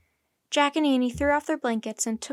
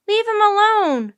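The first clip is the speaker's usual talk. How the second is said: high, loud protest; full high-pitched shout; yelling; 10 semitones higher, 10 dB louder than usual. A narrator's reading. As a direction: yell at them to back off. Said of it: "Annie yelled".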